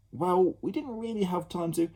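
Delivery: lightly voiced boy; Ned's voice. Ned answers.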